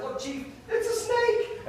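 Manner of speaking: spring the surprise in a high squeal; gotcha reveal. Retold or imitated. To the Chief, jumping out with the snake, a high playful startle.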